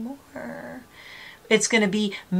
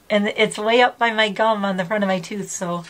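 ordinary talk

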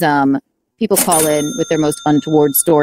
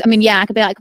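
usual speaking voice

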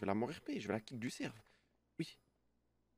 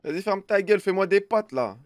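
loud; 7 semitones higher, 11 dB louder than usual. Real, not acted. Shouting at friend.

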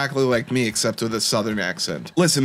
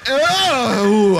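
high, loud startled "oh"; startled yelp; 10 semitones higher, 5 dB louder than usual. Real, not acted. Player being chased in the game, startled yelp.

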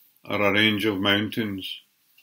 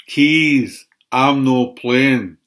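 loud, higher-pitched call, drawn out at the end; shouting; 4 semitones higher, 9 dB louder, much slower than usual. Acted. Player calling out of the tig game, loud shout. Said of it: "would be shouting".

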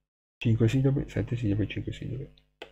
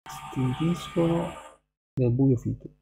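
loud, measured reading of a haiku; poem recital; 3 semitones higher, 5 dB louder, slower than usual. Acted. Reading a winter haiku aloud to viewers over the noise, loud recital. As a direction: project each line like a recital over noise.

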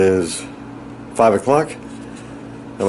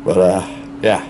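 blocked nose after surgery; nose packed, stuffy voice. Real, not acted. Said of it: "Can't talk right 'cause my nose is all, like, frickin' packed up".

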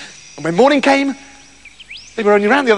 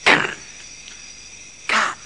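secretive, drawn-out whisper; donkey whispering to get attention; 8 dB quieter than usual. Acted. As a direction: hiss the call under your breath. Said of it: "whispered the donkey".

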